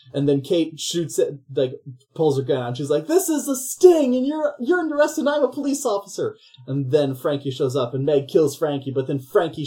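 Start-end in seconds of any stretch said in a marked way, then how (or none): 3.1-4.2 much higher, slowed, put-on announcing voice; mock cop reveal. Kate reveals herself as cop. 4.2-5.2 much higher put-on voice for you're under arrest; mock cop arrest. Kate's second arrest line. 5.2-6.4 much higher, slowed, put-on announcing voice; mock cop reveal. Kate reveals herself as cop.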